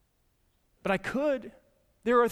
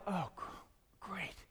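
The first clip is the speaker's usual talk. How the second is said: deflated realization; 14 dB quieter than usual. Retold or imitated. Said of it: "I'm like".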